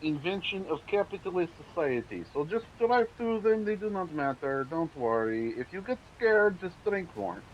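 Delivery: hammy broken-English Russian comrade; terrible Russian accent. Pretend. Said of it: "in terrible Russian accent".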